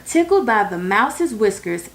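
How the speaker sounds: usual speaking voice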